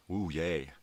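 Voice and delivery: sarcastic cheer. Acted. Sarcastic cheer at rival party.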